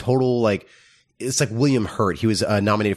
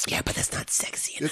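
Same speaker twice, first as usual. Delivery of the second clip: hushed, breathy aside; mock whisper brushing it off; whispered quote; 11 dB quieter than usual. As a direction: whisper it dismissively. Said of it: "it's like".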